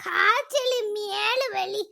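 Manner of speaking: soft, feeble complaint; weak and ailing. Acted. Sick wife lists symptoms to husband, weak and soft. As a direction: plead quietly as if too sick to talk.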